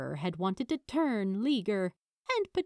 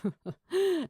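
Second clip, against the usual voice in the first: low chuckle; 10 semitones higher, 3 dB louder than usual. Acted. The father in the doorway, a low chuckle at the children. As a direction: chuckle warmly before speaking. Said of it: "A low chuckle came from the doorway".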